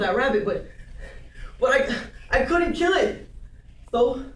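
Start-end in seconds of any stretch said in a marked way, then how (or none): 1.6-3.3 upset protest. Acted. Upset character recalls refusing to kill.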